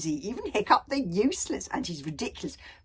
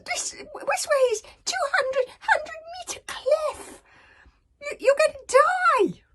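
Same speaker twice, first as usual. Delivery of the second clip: much higher, squeaky, stammering dragon; panicky little dragon; 6 dB louder, much slower than usual. Acted. Toothless panicking at Hiccup on the slope. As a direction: squeak in terror, stuttering.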